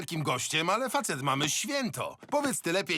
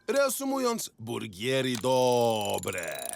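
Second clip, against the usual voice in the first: deadpan; 6 semitones lower, much slower than usual. Acted. Final line of a mock lecture.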